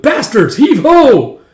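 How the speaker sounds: loud, high work-crew call; hauling shout. Acted.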